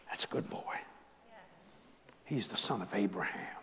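soft, low, tender voice of Jesus; hushed tender blessing; gentle Jesus. Acted. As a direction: speak it gently like a loving blessing.

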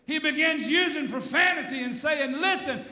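high shouted denial, drawn out at the end; loud, soaring pulpit storytelling; full-force preaching. Acted. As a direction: act out Peter's furious cursing. Preaches Peter's cursing at full force.

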